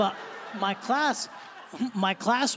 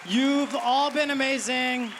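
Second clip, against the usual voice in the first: stage sign-off; 8 semitones higher than usual. Acted. Comedian's closing sign-off.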